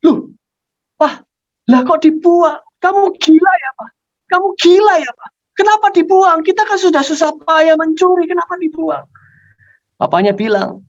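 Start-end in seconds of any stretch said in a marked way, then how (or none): 0.0-9.1 high-pitched outraged yelling; shocked. Acted. Child seeing papa throw the stolen gold away, shocked. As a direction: protest in disbelief, voice way up. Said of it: "Anaknya kaget".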